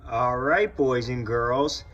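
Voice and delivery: ordinary delivery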